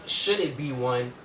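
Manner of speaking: usual speaking voice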